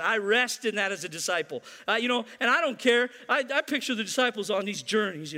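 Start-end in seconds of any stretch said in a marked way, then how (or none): none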